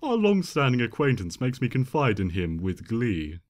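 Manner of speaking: ordinary delivery, nothing marked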